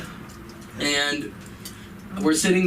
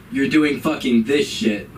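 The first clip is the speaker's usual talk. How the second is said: annoyed mom; 7 semitones higher than usual. Retold or imitated. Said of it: "my mom comes up to me".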